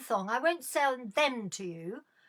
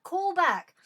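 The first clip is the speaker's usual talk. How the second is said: calling out; 7 semitones higher, 6 dB louder than usual. Real, not acted. Shouts for the caller to ring.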